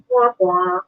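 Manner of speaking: high, mock failure jingle; imitated losing horn; sad trombone sound. Doctor's answer that the patient died, mock losing jingle.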